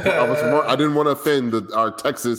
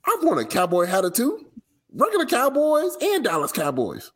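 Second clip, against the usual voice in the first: high, exasperated protest; 14 semitones higher than usual. Acted. Reading a rapper's complaint to Dallas, high-pitched exasperation. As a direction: keep the rant pitched up high. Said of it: "yelling at people is the way".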